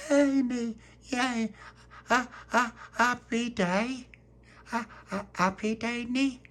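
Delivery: put-on very high baby-talk babble; happy toddler. Baby Lucia babbles happily.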